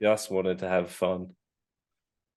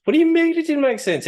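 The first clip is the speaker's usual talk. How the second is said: much higher and louder, indignant protest; outraged disbelief; 8 dB louder than usual. Real, not acted. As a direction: fire back, can't believe he said that. Defending the film, outraged.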